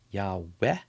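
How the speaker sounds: Yahweh said as yah-weh with an open ah, rising at the end; Yahweh pronounced. Shown as a demonstration. Teacher shows students how to say the name: Yahweh with a rise. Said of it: "So we say Yahweh".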